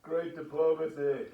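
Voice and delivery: sarcastic. Real, not acted. Sarcastic remark after outburst.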